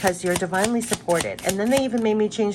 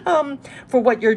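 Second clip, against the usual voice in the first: laughing; 6 semitones higher, 5 dB louder than usual. Real, not acted. Still laughing after wordplay.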